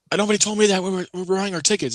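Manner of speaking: high-pitched mock indignation; indignant complaint. Customer protesting to the usher about the tickets, indignant. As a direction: imitate an irate customer protesting.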